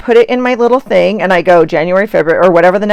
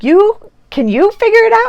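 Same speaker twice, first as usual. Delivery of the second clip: high squeaky comeback; mock challenge. Mom firing back at her daughter in a high mock voice. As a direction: toss it back in a high mocking voice. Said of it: "And I'm like".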